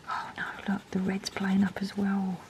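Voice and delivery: hushed dismay under the breath; a whispered oh no. Real, not acted. Reviewer noticing another pen failing, whispered dismay.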